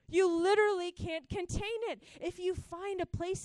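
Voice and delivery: loud, high-pitched preaching fervor; fervent preaching. Real, not acted. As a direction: build to an overflowing, insistent proclamation. Preaches at full force.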